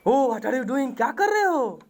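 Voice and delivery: high, exclaiming mock protest; alarmed grammar rushing in. Grammar rushing up to correct a pupil, high-pitched mock alarm. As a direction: cry out as if stopping a blunder. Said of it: "grammar will rush you".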